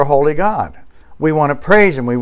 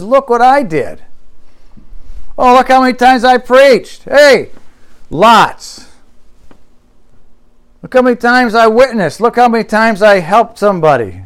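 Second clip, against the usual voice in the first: mock braggart, pitched up; bragging; 5 semitones higher than usual. Preacher voices a braggart boasting before the Lord, bragging. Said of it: "They were bragging about what they did".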